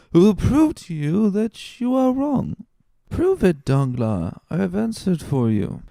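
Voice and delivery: put-on high, laughing drunken bluster; tipsy Caderousse. A narrator's reading.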